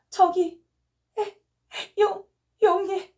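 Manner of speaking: high, slow, crying stammer. Acted. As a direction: stammer out the name through sobs. Delirious mother points at vision. (높고 느리게 울먹이며 더듬는 외침)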